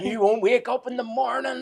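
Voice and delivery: high, loud hammed-up curse; mock witch threat. Witch cursing him at the door, hammed-up high threat.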